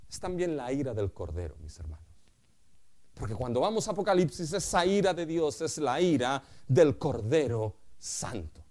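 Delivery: normal talking voice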